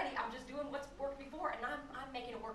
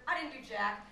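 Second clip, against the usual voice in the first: usual speaking voice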